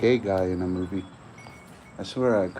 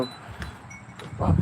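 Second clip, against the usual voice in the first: whispering; 5 dB quieter, much slower than usual. Real, not acted. Staring at the nose hair.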